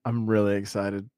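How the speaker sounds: deadpan excitement, no lift; flat excitement. Real, not acted. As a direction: say it flat though meaning it. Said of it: "Did I not just sound excited".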